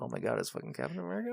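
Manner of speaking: high, soft, racing mock squeal; fast, high-pitched fan gushing; retold fan excitement. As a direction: imitate an audience losing it in hushed awe. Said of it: "and we're like".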